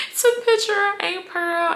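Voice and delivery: high, strained tearful quote; crying voice. Retold or imitated. Telling grandma through tears, high strained voice. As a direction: squeeze it out like you're choking up.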